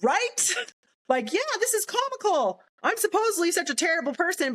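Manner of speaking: high-pitched mocking disbelief; incredulous scoff. Sergeant hearing that her record is empty, incredulous and vindicated. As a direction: scoff at how absurd the accusation is, pitch jumping up.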